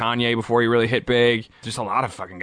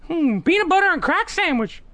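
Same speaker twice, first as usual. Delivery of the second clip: Tyrone Biggums impression. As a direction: squeak it out like a jittery addict. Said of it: "Tyrone Biggums is my favorite character".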